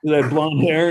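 normal talking voice

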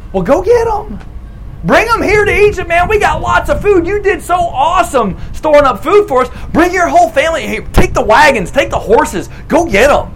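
high, bright-eyed generous king; eager Pharaoh. Acted. Pharaoh telling Joseph to fetch everyone, high and eager. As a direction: Pharaoh cheering Joseph to bring his family, eagerly.

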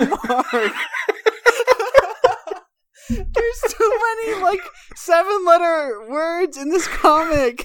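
high-pitched laughing through words; crying with laughter; laughing hysterically. Real, not acted. Laughing fit over hard comic.

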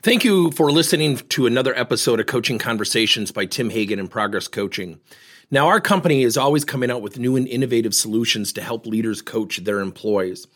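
promotional read. Acted. Podcast host thanks listeners and pitches coaching service, promotional read. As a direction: deliver it as a scripted company promo.

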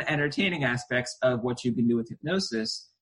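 ordinary talk, nothing marked

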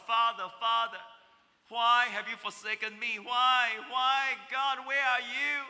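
loud, pleading cry to the Father; anguished cry. Acted. Jesus crying out to the Father in anguish.

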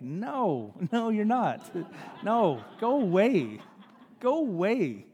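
drawn-out mock rebuff with a swooping tune; teasing brush-off; comic dismissal. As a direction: playfully wave him off, pitch swooping. Acted comic rebuff of the husband.

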